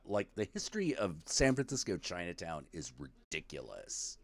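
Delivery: ordinary talk, nothing marked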